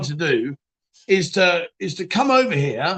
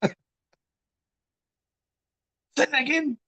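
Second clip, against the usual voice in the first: high, softer incredulous question; mock disbelief; 8 semitones higher, 4 dB quieter than usual. Stranger reacting to detective's odd job, mock disbelief. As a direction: pitch it up as if you misheard.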